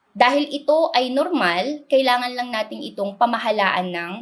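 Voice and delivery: ordinary talk, nothing marked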